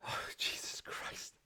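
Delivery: slow, breathless whisper after a fright; shaken whisper. Real, not acted. Streamer catching breath after the scare, whispering. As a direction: let it out on an exhale, still rattled.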